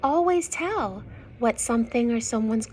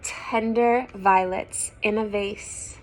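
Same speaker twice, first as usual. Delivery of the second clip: clear, louder storybook reading; story narration; 8 dB louder than usual. Acted. Reading a picture book to young children, lively narration. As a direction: read the rhyme out to the children.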